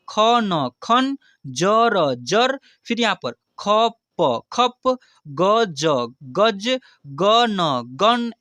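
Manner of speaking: slow, letter by letter, then the word; slow spelling out. Shown as a demonstration. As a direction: spell each sound apart, then join. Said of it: "लेकिन इसको फटाफट से जल्दी में कैसे पढ़े". (धीरे धीरे अक्षर अलग करके)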